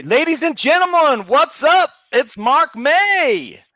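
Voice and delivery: big, high arena-announcer intro, stretching the name; hype announcer. Acted. Reading a fan's message aloud as a hyped announcer. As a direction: announce him like a ring MC. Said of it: "You gotta read it the other way".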